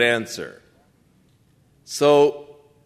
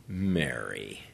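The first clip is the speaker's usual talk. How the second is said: tender; 12 semitones lower, 10 dB quieter than usual. Retold or imitated. Jesus calls Mary tenderly.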